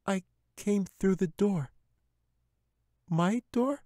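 put-on high young girl's voice; little girl. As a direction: reassure the bear like a frightened little girl. Katy explains.

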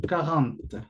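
"quarante" con r francesa y nasal, sin la e final; French pronunciation. Shown as a demonstration. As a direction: di quarante como kaʁant. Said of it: "La pronunciación más o menos aproximada". (pronuncia quarante a la francesa)

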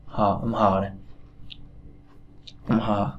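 "hờ" with a throaty breath like "khờ"; teacher breathes "hờ" from the throat; Chinese h sound. Shown as a demonstration. Teacher drills the Chinese h sound for students. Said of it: "âm hờ trong tiếng Trung thì nó đọc giống như âm khờ".

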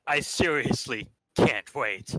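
ordinary talk, nothing marked

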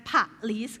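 "police" split as puh-LEES; syllable-by-syllable pronunciation. Shown as a demonstration. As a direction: break "police" into a short puh, then long LEES. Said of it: "ไม่อ่านว่า police นะคะ อ่านว่า". (ออกเสียง "police" แยกเป็น "ผะ-ลีส")

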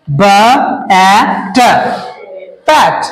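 ब, ए, ट split into separate sounds, then बैट blended; sounding out. Shown as a demonstration. Teacher sounds out बैट letter by letter for pupils. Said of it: "अभी मैं पढ़ना सिखाता हूँ".

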